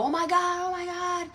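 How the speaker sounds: high-pitched panicked wail, held back in volume; thin-volumed, high imitation of horrified cries; mock screaming. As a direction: imitate siblings screaming without really shouting. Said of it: "My brothers and sisters are screaming".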